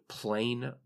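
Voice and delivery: usual speaking voice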